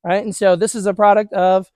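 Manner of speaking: normal talking voice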